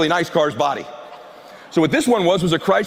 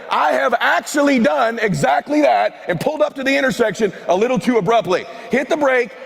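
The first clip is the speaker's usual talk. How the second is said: excited shouting; 6 semitones higher than usual. Real, not acted. Speaker to the crowd, confessing his headlight flew off, shouting excitedly.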